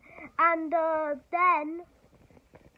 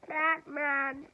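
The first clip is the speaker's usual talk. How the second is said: lightly voiced whiny nerd, a bit higher and slow; whiny Riddler voice; 3 semitones higher, slower than usual. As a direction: whine it out like a pleading nerd. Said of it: "in a loud, a whiny voice".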